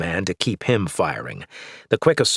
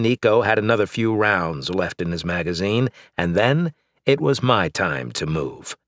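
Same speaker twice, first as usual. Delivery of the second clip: plain reading voice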